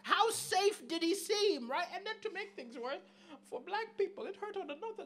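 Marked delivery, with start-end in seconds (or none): none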